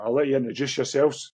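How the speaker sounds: ordinary talk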